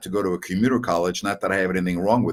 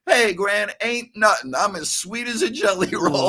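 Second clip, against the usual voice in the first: imitating Carl; 10 semitones higher than usual. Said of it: "And he would go".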